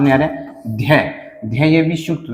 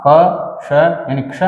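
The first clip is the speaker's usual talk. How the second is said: क श यानी क्ष said very slowly, sound by sound; slow breakdown; much slower than usual. Shown as a demonstration. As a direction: spell out क and श, then join them.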